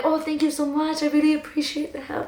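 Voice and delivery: high, dainty grateful little man; little Irishman. Retold or imitated. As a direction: imitate a tiny leprechaun giving thanks. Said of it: "The little Irishman, he was like".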